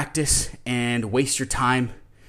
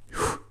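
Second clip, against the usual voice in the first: strained exhale; 4 dB quieter than usual. Real, not acted. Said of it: "this is gonna be a long".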